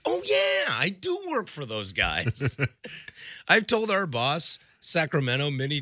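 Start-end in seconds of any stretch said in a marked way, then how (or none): 0.1-2.6 high-pitched retold realization; delighted realization. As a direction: act out suddenly remembering, starting high. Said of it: "And I'm like".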